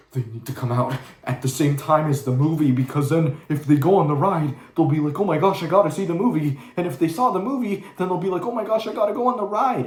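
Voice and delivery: high, loud, far-off mock pitch voice; mocking executive voice. Vlogger parodies Disney's tie-in movie logic, mocking. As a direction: parody a greedy studio planner. Mocking studio marketing reasoning.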